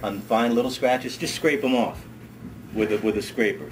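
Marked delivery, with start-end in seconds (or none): none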